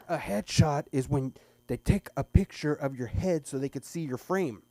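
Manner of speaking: mock teacher explaining a headshot; exasperated teacher.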